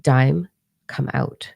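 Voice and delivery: slow, otherworldly calling of her name; lightly voiced spirit beckoning her out. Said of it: "I heard c-, very clear in my ears".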